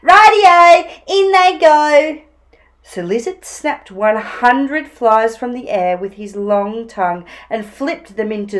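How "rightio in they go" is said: high, loud gleeful call; jaunty dingo catchphrase; cheering Dingo. Acted. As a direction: sing out the go-ahead.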